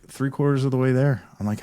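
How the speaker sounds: usual speaking voice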